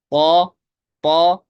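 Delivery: teacher demonstrating the full पौ; heavy pur demo. Shown as a demonstration. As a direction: belt out पौ with a heavy back-of-mouth sound. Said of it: "इस तरह हमने बोला था ना पहले".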